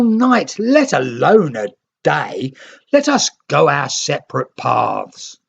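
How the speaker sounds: high, loud scornful taunt; mock the newcomers' offer; mocking refusal.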